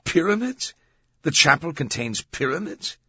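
high, loud disbelieving questions; incredulous. A narrator's reading. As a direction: blurt it out, unable to believe your ears. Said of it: "could scarcely believe his ears".

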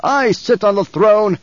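barked. A narrator's reading. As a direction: bark it at him. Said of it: "he barked".

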